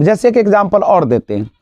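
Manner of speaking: ordinary talk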